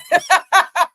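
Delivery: high, loud laugh; burst of laughter. Real, not acted. Reacting to clip.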